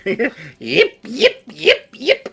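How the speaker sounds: high yapping little dog; yappy chihuahua voice. Acted. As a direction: yip like a tiny frantic dog. Player voicing character yipping.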